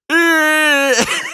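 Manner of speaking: long, loud squeal breaking into laughter; high-pitched squeal. Retold or imitated. Repeating his awkward greeting, high laughing squeal. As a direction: squeal it out high, then crack up.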